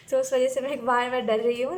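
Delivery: real embarrassed laugh through the words; laughing admission. Real, not acted. Tarot reader admitting her fear of a lizard, laughing. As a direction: laugh while owning up to a fear.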